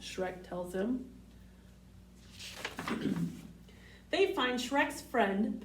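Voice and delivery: plain reading voice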